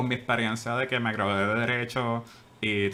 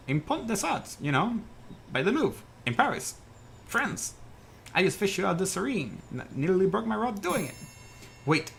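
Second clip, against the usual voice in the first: higher, friendly fisherman voice; fisherman character; 6 semitones higher than usual. Acted. Fisherman tells the dazed lawyer where he is, in a higher voice. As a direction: play the chatty fisherman who fished him out.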